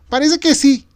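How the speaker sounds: very high, loud, delighted woman; joyful confirmation; happy. A narrator's reading. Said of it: "La notaba feliz". (mujer feliz, aguda y fuerte)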